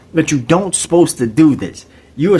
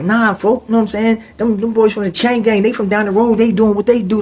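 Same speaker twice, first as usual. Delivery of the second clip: higher, laid-back brush-off; lightly voiced roommate; 8 semitones higher than usual. Roommate brushing off the inmates, lightly voiced. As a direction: wave them off.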